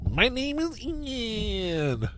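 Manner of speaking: high, very drawn-out goofy intro; mock co-host voice. Host mimicking his co-host's intro, drawn-out mock voice. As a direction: stretch the name out long and high. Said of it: "I'll talk like this".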